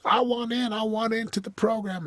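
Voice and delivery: high, excited pleading voice of a would-be client; eager client mimic. Coach imitating eager clients, high excited plea. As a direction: mimic their eager begging to join.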